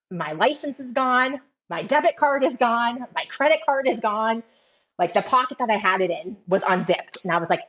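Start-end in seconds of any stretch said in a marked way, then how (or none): 0.1-4.4 retold panic. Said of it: "So now I'm freaking out".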